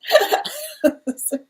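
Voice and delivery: sudden high laugh; high burst of laughter. Real, not acted. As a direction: break into a real, high-pitched laugh. Reader laughs at the energy.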